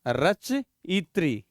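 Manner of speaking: "रचयित्री" stretched out, with a clear tri; very slow pronunciation. Shown as a demonstration. Teacher dictating the feminine form "रचयित्री" slowly.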